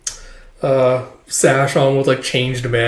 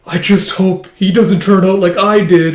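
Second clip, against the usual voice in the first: higher, louder worried earnest voice; earnest senior; 7 semitones higher, 6 dB louder than usual. Retold or imitated. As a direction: sigh out the worry in a higher voice.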